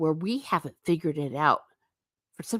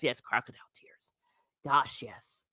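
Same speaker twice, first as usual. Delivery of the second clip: soft fast whisper; whispered aside; 6 semitones lower, 9 dB quieter than usual. Real, not acted. Agreeing with a viewer in a quick whisper. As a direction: breathe it out under the breath. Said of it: "whispered aside".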